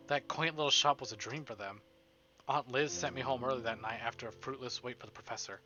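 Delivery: ordinary delivery, nothing marked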